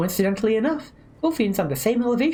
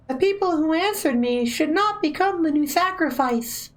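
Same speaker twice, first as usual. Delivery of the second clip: Helena's voice; 9 semitones higher than usual. Acted. Streamer voicing Helena.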